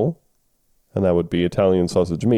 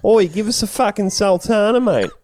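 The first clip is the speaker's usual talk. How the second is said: higher, hammed-up Aussie bogan demand; broad mock Australian accent, pitched up; 11 semitones higher than usual. Shown as a demonstration. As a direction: show how sultana fits a broad Aussie yell. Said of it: "you can't do sultana".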